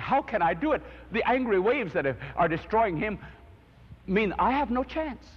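put-on a boy frantic to save his drowning brother; desperate inner cry. Dreaming boy panics as his brother drowns, urgent and high.

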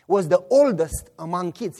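usual speaking voice

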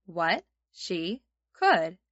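very slow, each word spaced; slow phrase-by-phrase. Shown as a demonstration. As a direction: say it slowly for kids to repeat. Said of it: "There's the first three".

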